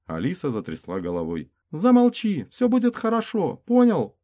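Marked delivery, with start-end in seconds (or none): 1.7-4.2 much higher, loud desperate plea; high frightened woman's voice; pleading female voice. Acted. Frightened woman silences wounded.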